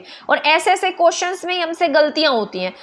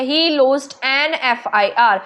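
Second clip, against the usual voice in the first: very slow, letter by letter; careful teacher read-aloud; slow demo read; much slower than usual. Shown as a demonstration. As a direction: spell out each letter slowly. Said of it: "ए की sound आ रही है".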